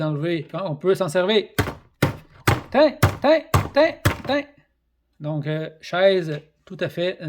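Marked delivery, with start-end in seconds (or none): 2.7-4.4 fight yell. Acted. Acting out chair shots.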